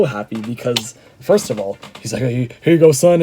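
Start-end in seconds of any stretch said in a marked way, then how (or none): 2.2-3.2 loud gruff Texan growl, calling him son; imitating Stone Cold, much louder; Stone Cold Steve Austin voice. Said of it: "in the most Stone Cold Steve Austin voice ever".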